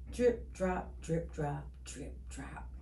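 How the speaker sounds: drip drop fading down to a whisper. A narrator's reading. A child listening to the rain, fading to a whisper.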